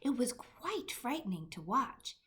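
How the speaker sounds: ordinary delivery, nothing marked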